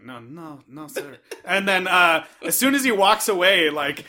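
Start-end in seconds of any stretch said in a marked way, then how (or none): 0.0-1.2 quiet hushed denial. Retold or imitated. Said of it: "he's like".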